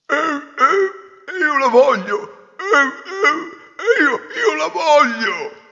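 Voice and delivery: high, loud, slow grunting insistence; grunting pig demand. Acted. Pig prince insisting on the third daughter, slow high grunts. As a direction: grunt and stamp like a stubborn pig.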